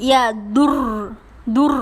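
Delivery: ইয়া দুর with a heavy trilled ending; thick R. Shown as a demonstration. Teacher demonstrates R after pesh, heavy ইয়া দুর. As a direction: hold the rolled R of দুর. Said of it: "মোটা".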